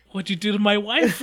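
playfully accuse, pitch pushed up; mock outrage. Co-host jokes as a wronged husband, mock outrage.

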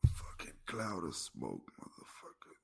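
hushed whispered curse; breathy whisper under the breath. Real, not acted. Coughing through smoke, whispered aside.

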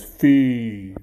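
big, slow, booming chant; menacing giant. Acted. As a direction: draw out each word like a threatening giant. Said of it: "this giant says".

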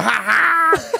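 high loud burst of laughter; cracking up at the memory. Real, not acted. Laughing retelling the joke.

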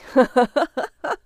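loud run of staccato ha-ha-has; loud laugh. Acted. Laughter during a couple's squabble, loud. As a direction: laugh out loud in a choppy burst. Laugh within the acted quarrel.

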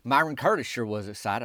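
plain reading voice

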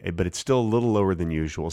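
ordinary talk, nothing marked

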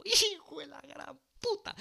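high squealed curse; hammed-up high-pitched dismay; mock panicked cry. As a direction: squeal it in comic alarm. Acts out his panicked reaction.

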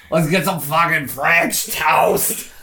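lightly voiced drunken slur, slow; drunk woman. Podcaster imitates the drunk girl driving, tipsy. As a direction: drag it out like a mock drunk. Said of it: "like, the way she says it".

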